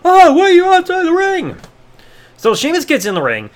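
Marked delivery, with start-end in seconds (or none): 0.0-1.6 high-pitched mock McGillicuddy. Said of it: "McGillicuddy would realize".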